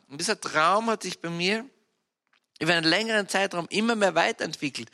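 ordinary delivery

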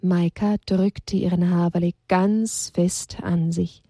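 ordinary delivery

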